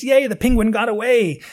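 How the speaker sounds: high, loud mock cheer; rejoicing. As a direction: cheer like a relieved TV viewer. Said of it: "we always rejoice".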